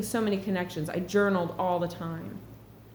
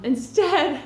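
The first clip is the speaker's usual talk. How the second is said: a higher, louder instead through a laugh; 9 semitones higher, 7 dB louder than usual. Shown as a demonstration. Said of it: "this is so strange".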